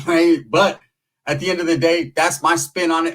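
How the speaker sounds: ordinary delivery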